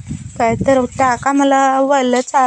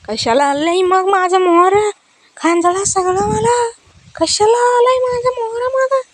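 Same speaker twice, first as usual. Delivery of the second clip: high, tearful pet voice whining for more food; 10 semitones higher than usual. Pretend. Owner voicing her pet crying for food, high whining voice. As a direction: sob out the complaint like a greedy pet, high.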